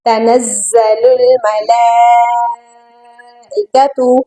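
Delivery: slow recitation with "मल" greatly stretched; recite, holding the vowel of "मल" long; long madd recitation. Shown as a demonstration. Teacher reciting for students, slow tajweed with long madd. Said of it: "बहुत खींच कर पढ़ना है".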